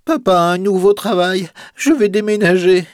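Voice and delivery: a boy sighing out bad news; sad little boy. A narrator's reading. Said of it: "Octave soupire avec tristesse".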